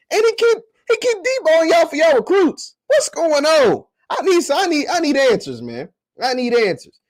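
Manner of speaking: rile up the Southern fans with high, gleeful jabs; excited teasing. Real, not acted. Teasing rival fans over lost recruits.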